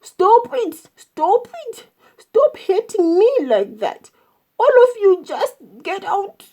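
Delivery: high, loud cries of protest; groaning shout. A narrator's reading. Elephant kicked from inside his stomach, shouting in distress. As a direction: yelp the orders as if in pain. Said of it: "groaning and holding his stomach and shouting".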